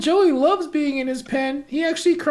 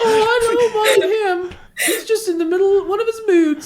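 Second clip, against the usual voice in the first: laughing it off; 3 semitones higher, 3 dB louder than usual. Acted. Mother laughing off husband.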